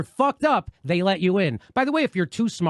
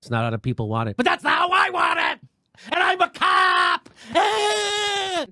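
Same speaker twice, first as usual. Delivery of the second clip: shouting, whiny cop caricature with a higher voice; mock angry cop; 6 semitones higher than usual. Host mocking a cop who demands respect, shouted caricature.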